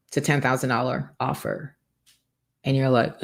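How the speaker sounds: normal talking voice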